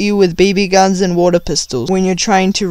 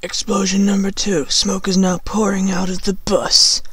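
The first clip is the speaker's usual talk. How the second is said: usual speaking voice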